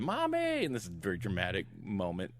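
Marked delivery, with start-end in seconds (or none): 0.0-0.6 high mock yell of a kid calling mommy; retold yell. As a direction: call out for mommy like a scared little boy. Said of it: "his brother's yelling".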